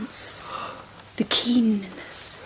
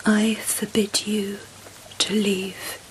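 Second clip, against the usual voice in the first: hushed tender command; seductive whisper. Acted. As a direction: whisper orders like a caress. Empress seductively commanding the emperor.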